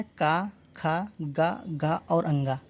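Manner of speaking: each syllable slow and spaced, का, खा, गा, घा, अंगा; slow syllable drill. Shown as a demonstration. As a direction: spell out the sounds one at a time, very slowly. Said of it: "का वर्ग में क्या आता है?".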